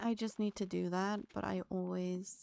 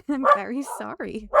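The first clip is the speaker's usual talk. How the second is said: louder and higher, laughing through the apology; 4 semitones higher, 12 dB louder than usual. Real, not acted. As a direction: giggle out a sorry after scaring a friend. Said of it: "for the jump scare".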